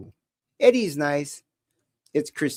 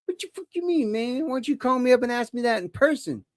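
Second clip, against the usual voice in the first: mock indignant challenge; 7 semitones higher, 3 dB quieter than usual. Mock-confronts someone questioning the cross.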